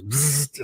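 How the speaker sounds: buzzing zap noise; imitated electric zap. Man recalling shocks during his nerve test, imitated zap. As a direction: mimic a sudden electric buzz. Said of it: "all of a sudden I hear this".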